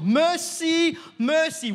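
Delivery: high, loud cry of mercy; yelled plea. Retold or imitated. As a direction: shout it like a desperate blind beggar. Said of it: "he yelled".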